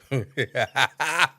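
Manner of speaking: wheezing laugh. Real, not acted. Laughing after retelling.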